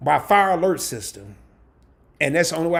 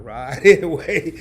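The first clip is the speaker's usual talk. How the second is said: ordinary delivery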